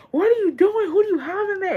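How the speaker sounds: high, shocked mom demanding answers; scolding mom. Retold or imitated. Mom confronts uncle in the shed, high shocked scolding. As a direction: imitate mom catching him out.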